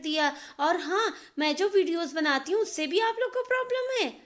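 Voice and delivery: put-on high, loud furious young wife; angry phone rant. Neha ranting at her husband on the phone, loud and high. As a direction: shout down the phone at him, all grievances.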